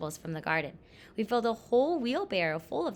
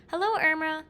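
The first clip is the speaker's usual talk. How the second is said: high, louder neighborly hail; raised-voice greeting, pitched up; calling out; 7 semitones higher, 6 dB louder than usual. A narrator's reading. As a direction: call a hello over to a neighbor. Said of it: "my mother called to Miss Cummerman".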